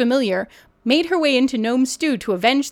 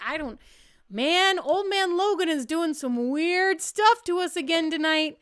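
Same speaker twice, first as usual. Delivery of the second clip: ordinary talk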